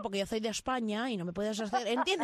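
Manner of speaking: mock Spanish-from-Spain lisp; lisping andalusian accent. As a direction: put on a Castilian lisp. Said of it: "aprendiendo a hablar con la Z". (acento andaluz ceceante)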